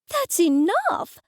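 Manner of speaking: high, slow, sharp halt; mother shutting down the funeral party idea, appalled; stern shock. A narrator's reading. Mother cuts off daughter.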